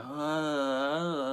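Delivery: long groggy, wavering "ah"; dazed moan. Retold or imitated. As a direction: imitate coming round from anesthesia. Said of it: "I'm dazing".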